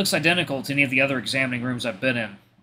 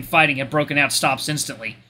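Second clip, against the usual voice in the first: plain reading voice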